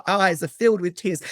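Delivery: ordinary delivery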